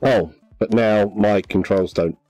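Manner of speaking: normal talking voice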